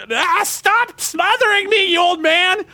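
put-on high, slow kid groaning at his dad; a kid telling his overprotective dad off, high and slow; fed-up kid. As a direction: drag it out like a smothered kid. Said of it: "that much of a gut, like".

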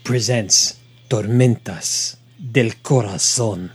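slow, low, sultry Spanish announcer; sexy Spanish accent. Acted. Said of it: "the sexy Spanish accent".

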